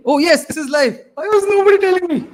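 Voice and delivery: loud, high-pitched mock-indignant outburst; thrilled, exasperated exclamation; relieved excitement. Real, not acted. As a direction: burst out with it, voice shooting up loud. Finally learns the stream is live.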